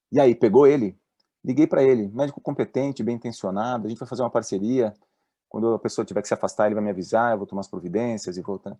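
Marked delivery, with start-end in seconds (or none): none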